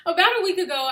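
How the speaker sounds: composed restart after laughing; refocused retake. Real, not acted. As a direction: reset and deliver it straight. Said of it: "focus, [name]".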